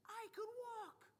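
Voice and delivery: high, drawn-out awed realisation; dawning wonder. Acted. Lifelong cripple thinking he could walk, in dawning wonder. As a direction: let the words rise high and slow in amazement.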